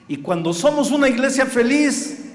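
high, loud preacher's shout; full-force preaching. Real, not acted. Pastor exhorting the congregation to be a happy church, full-force preaching.

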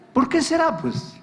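pointed repeat. Retold or imitated.

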